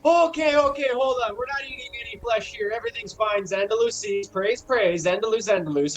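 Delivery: high, hurried placating voice; flustered cult doctor. Acted. Doctor halting talk of eating flesh, flustered placating.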